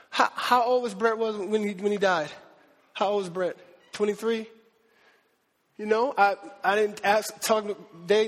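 fighting back tears as he asks; choked up, barely holding it together; real crying. Real, not acted. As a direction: voice breaking with real tears, quiet and low. Preacher cries remembering dead friend.

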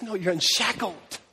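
soft, slow, calm correction; quietly but firmly reassure; gentle insistence. Retold or imitated. Jesus answers the doubter.

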